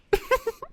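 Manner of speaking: high, soft motherly giggle; little giggle in a very high voice. A narrator's reading. Windy teases her daughter about news, high giggle. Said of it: "Windy giggled".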